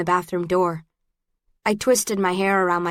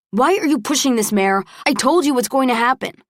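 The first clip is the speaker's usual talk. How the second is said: heated, raised-voice reproach; angry quarrelling; 5 semitones higher, 4 dB louder than usual. A narrator's reading. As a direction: confront her, higher and more forceful. Angry quarrel between couple.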